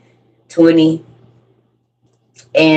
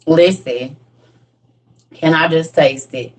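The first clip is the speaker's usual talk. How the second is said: usual speaking voice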